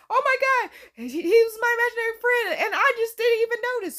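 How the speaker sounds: high, hurried mock dismay; high-pitched imitation of a stunned girl; shocked realization. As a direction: blurt out an acted realization. Acting girl's shocked realization.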